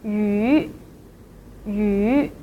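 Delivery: "yu" held, starting low then sweeping up; long "yu" lifted at the end; second tone yu. Shown as a demonstration. Teacher shows learners the yu sound, rising "yu". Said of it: "here is the yu sound".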